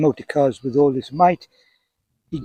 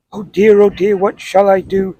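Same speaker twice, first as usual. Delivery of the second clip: higher, louder motherly fretting; 4 semitones higher, 5 dB louder than usual. A narrator's reading. Mother to her car-mad little son, fretting. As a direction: worry aloud like an anxious mother, pitched up. Poem voices a worried mother.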